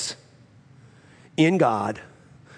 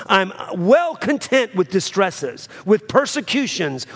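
high, louder preaching climb; full-force preaching; 6 semitones higher, 4 dB louder than usual. Acted. Pastor exhorting the church through trial, full preaching. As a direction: lift the congregation with each phrase. Preacher rises again quoting Paul.

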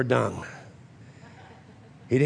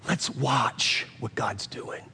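slow, low and soft; hushed urging; 6 semitones lower, 7 dB quieter, slower than usual. Retold or imitated. Son to dad at the bedside, slow hushed urging. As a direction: quietly hold dad back, unhurried.

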